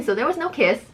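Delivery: usual speaking voice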